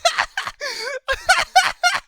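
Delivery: high, loud cackling laugh; real uncontrollable laughing fit; helpless laughter. Real, not acted. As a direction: crack up completely. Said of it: "I'm happy now 'cause I'm laughing".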